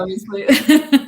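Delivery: drawn out slowly through a laugh. Real, not acted. Guest jokes with host about barking dog, laughing. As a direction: let the word melt into a chuckle. Joking about the dog's opinions.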